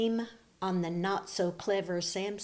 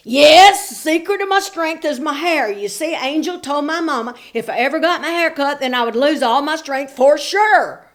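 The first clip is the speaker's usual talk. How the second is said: loud earnest Samson; 6 semitones higher, 12 dB louder than usual. A narrator's reading. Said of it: "Samson explaining".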